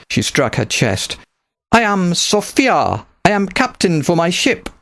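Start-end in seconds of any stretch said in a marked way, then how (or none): 1.7-4.7 put-on higher, thick-accented rebel captain, proud; accented pirate captain. A narrator's reading. Pirate captain recruiting Nicholas to her fleet, thick accent. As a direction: speak as a bold foreign pirate captain. Sophia introduces herself.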